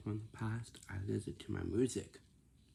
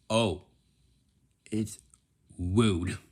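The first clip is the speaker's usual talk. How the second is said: loud; 11 dB louder, slower than usual. Shown as a demonstration.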